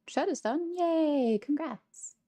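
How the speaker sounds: high, bright celebration; excited high cheer. Real, not acted. Celebrating finished chore.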